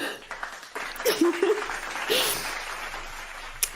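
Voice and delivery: airy, high-pitched giggle through tears; real laughter, soft and high; high breathless laugh. Real, not acted. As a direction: laugh off the emotion.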